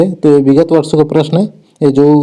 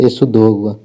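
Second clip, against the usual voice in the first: ordinary talk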